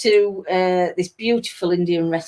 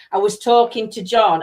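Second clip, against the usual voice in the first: ordinary delivery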